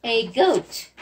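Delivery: normal talking voice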